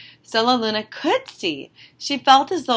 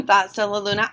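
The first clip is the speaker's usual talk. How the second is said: ordinary delivery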